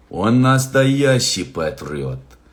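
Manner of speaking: lightly voiced Russian soldier, slow and weighty; gruff soldier voice. Voices the character's quote.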